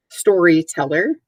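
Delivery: Storyteller drawn out, then spelled; spelled out slowly. Shown as a demonstration. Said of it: "spelling the word".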